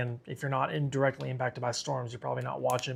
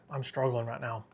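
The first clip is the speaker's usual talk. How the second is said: ordinary talk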